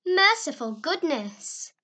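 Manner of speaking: startled cry. A narrator's reading. Old aunt woken as girls land on her, startled cry. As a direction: cry out in shock from under the covers. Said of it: "said in muffled accents".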